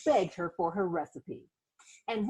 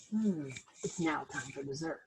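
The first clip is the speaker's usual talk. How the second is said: diners' voice; 7 semitones lower, 7 dB quieter than usual. Acted. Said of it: "all the diners said".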